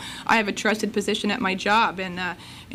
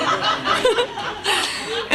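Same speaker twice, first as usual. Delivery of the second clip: high laughter; 12 semitones higher than usual. Real, not acted. Laughing after the swoon.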